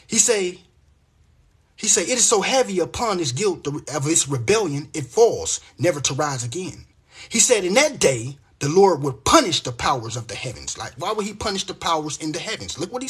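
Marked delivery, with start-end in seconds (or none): 2.0-10.8 measured, rhythmic Bible reading, a little louder; scripture reading. Acted. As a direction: read the verses like a preacher. Reads Isaiah aloud.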